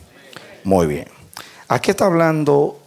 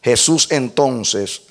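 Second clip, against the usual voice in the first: usual speaking voice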